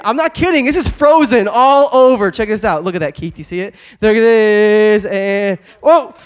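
loud, thrilled shouting while riding around; real thrill, calling out to the crowd mid-ride; excited scooter ride. Real, not acted. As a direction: whoop it up on the scooter. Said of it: "If you wanna see me get crazy".

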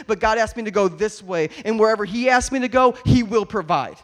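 builds from lively to loud, ringing preaching; preaching at full force. Acted.

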